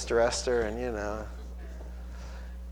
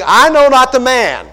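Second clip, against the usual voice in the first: loud, higher protest; cursing denial; 7 semitones higher, 8 dB louder than usual. Acted. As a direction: swear it off loudly. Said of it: "began to curse and swear".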